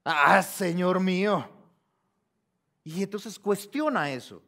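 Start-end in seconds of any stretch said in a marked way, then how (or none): 0.0-1.5 loud, slow, long-drawn lament; exaggerated sighing "ah". Shown as a demonstration. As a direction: act out Gideon's big doubting sigh. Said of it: "ese A con H, hazle, ah".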